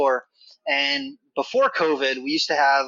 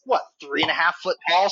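ordinary talk

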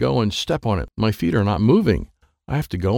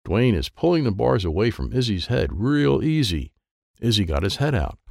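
usual speaking voice

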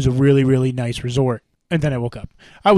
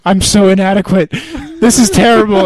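mock self-pity; 5 semitones higher, 3 dB louder than usual. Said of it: "I'm like".

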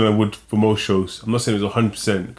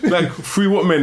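incredulous; 7 semitones higher, slower than usual. Real, not acted. Mocking the strength claim.

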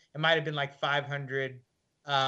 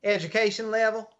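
higher, brisk skeptical nurse question; 7 semitones higher, 4 dB louder than usual. Retold or imitated. Nurse eyeing his slight frame, skeptical and higher. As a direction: sound unconvinced by him. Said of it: "She examined my slight frame and frowned".

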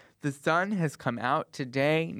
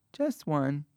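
ordinary talk, nothing marked

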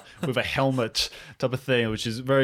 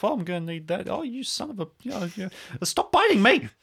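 mumbling to himself; 10 semitones higher than usual. Acted. Rummaging through trash, mumbling to himself then snapping. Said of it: "he's mumbling to himself".

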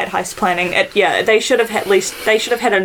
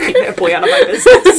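high, loud mock-angry grumble; grumpy Brom voice; 12 semitones higher, 6 dB louder than usual. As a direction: play the cranky wizard shooing the boy. Brom voiced, annoyed at Aragorn.

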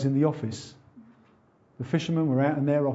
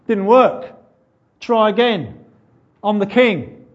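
loud, higher cocky king's boast; mock taunt; 6 semitones higher, 8 dB louder, slower than usual. Jesus taunting the Sanhedrin and Pharisees, loud mock boast. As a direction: gloat at them like a triumphant king.

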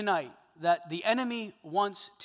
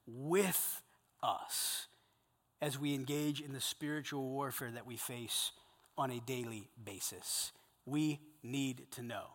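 lower, softer and slow; soft earnest prayer; 4 semitones lower, 7 dB quieter than usual. Acted. Preacher praying for his men, softer and slow. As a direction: pray it quietly over the men.

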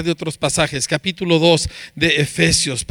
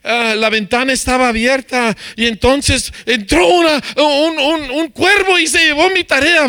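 put-on higher-pitched kid spinning a tall excuse; niño inventando excusas; 7 semitones higher than usual. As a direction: play a child stalling, building higher as the story grows. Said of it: "tu hijo te empieza a contar todo un rollo". (niño con excusas, voz cada vez más aguda)

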